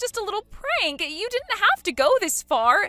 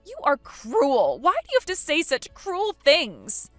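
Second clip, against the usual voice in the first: hurt accusation; 3 semitones higher, 7 dB louder than usual. Acted. Bride calling her sister-in-law cruel, hurt accusation. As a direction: cry out hurt.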